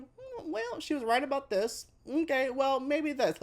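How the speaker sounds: a hint of grudging, wavering agreement. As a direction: reluctantly admit she has a point. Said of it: "but you start going".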